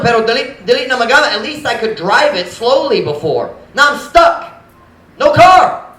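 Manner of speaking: high, loud exasperated plea to God; worked-up, acted-out exasperation; frustrated complaint. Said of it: "I was frustrated. I'm just going".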